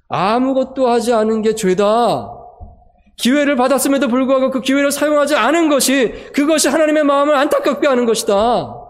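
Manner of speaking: high, loud impassioned sermon peak; pastor's impassioned climax to the congregation; fervent preaching. Acted. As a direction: preach it at full force.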